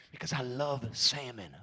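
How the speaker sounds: normal talking voice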